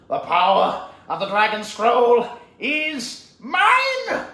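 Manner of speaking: slow, high, loud villain triumph; hammed-up victorious villain declaration; Tai Lung. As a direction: gloat grandly, stretching every word. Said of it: "and Tai Lung goes".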